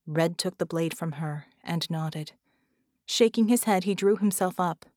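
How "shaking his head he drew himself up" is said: ordinary delivery, nothing marked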